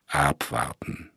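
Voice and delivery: steady the men with a quiet order; calm and unhurried, a quiet command. Acted. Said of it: "befahl der Inspektor mit ruhiger Stimme".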